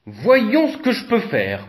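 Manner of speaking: ordinary delivery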